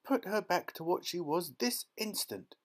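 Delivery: high, indignant demand; mother's yell. A narrator's reading. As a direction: demand it at once. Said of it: "yelled Missus Beauregard".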